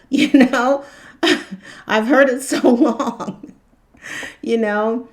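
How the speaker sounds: laughing through the words. Real, not acted. Mocking the end-days talk to viewers, laughing. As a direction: chuckle as you say it.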